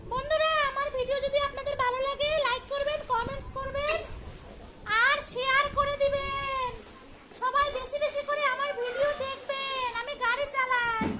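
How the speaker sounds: cheerful channel sign-off to viewers; vlogger sign-off. Acted. Child vlogger asking friends to like and share.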